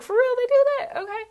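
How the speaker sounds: very high squeaky mock amazement; amazed disbelief. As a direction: squeal it like you can't believe it. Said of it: "I was like".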